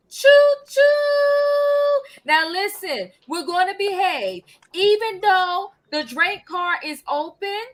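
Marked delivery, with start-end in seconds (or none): 0.1-2.2 much higher, slow and louder; sing-song choo choo. Acted. Host hyping the full panel, high drawn-out choo choo. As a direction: stretch it out like a train horn. Host hams up train theme.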